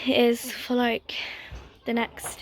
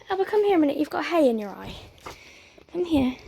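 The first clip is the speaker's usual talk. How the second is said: calling the dog; 5 semitones higher, 3 dB louder, faster than usual. Real, not acted.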